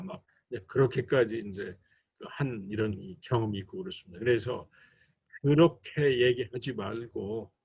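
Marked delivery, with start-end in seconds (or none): none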